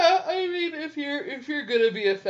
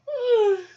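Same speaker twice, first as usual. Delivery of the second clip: loud yawn; 13 semitones higher, 8 dB louder than usual. Real, not acted. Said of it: "you're not supposed to yawn".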